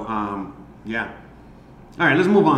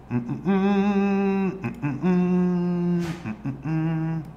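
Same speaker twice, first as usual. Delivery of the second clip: high hummed filler notes; idle high-pitched humming; 6 semitones higher, much slower than usual. Real, not acted. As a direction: hum three short phrases while searching. Hums while hunting for a picture.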